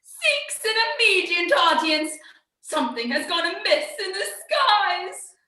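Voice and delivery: high, prim courtier voice; fussy chamberlain. Acted. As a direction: announce it like a fussy servant.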